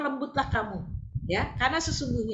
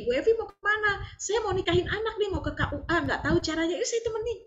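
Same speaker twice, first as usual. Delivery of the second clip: playful voices; 8 semitones higher than usual. Retold or imitated. Acted dialogue with Bu Evi.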